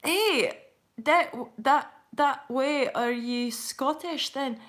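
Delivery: a shaky attempt at a Scottish accent, slowing on the question; trying out a loose Scottish voice; light, unsure Scottish accent. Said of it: "I'm not quite as solid with the Scottish accent".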